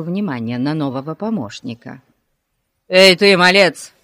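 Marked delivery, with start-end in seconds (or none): 2.9-3.9 loud policeman's bellow; angry yell. A narrator's reading. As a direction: bawl at a boy to stop him. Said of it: "заорал он". (сердитый окрик)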